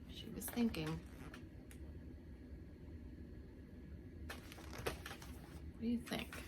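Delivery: hushed, slow whisper; suspense whisper. A narrator's reading. Asking the children in a hushed whisper. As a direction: draw listeners in secretly.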